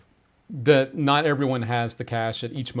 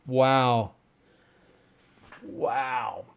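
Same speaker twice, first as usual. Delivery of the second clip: ordinary talk, nothing marked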